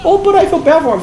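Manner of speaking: high-pitched mock sympathy; imitating pitying girlfriend. Voices girlfriend's pitying objection.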